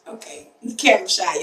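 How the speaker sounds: ordinary talk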